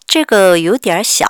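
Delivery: very slow, spelled-out Chinese; teacher drilling slowly; slow model reading. Shown as a demonstration. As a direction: model each syllable slowly for learners. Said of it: "teacher models sentence slowly".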